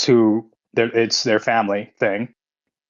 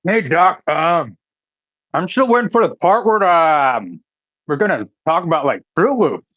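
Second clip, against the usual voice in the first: talking with a mouthful of cereal; eating cereal; 6 semitones higher than usual. Real, not acted. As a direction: chew and talk at once. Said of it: "'cause I'm eating a bowl of cereal right now".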